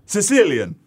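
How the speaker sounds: pizza man's reply. Retold or imitated. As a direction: call it out as an Italian-American pizza man. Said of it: "And he said".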